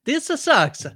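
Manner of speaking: high-pitched, loud mock Italian accent. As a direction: ham up a cartoon Italian accent. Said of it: "was that an Italian accent".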